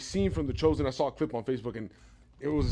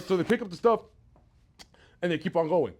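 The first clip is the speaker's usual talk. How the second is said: ordinary talk, nothing marked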